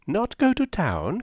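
high, slow, amused disbelief; incredulous Mrs Palmer. Acted. Mrs Palmer crying out at the sisters in high amused disbelief. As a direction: gasp it out in merry surprise. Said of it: "cried Missus Palmer, with a laugh".

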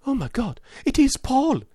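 high, astonished woman's outburst; shocked cry. A narrator's reading. Woman shocked to recognise captive.

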